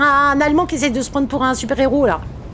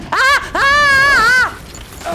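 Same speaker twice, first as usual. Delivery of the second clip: high-pitched scream of fright; 10 semitones higher, much slower than usual. Real, not acted. Streamer screams in fright while playing. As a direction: let out a panicked high scream. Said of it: "J'ai pas crié".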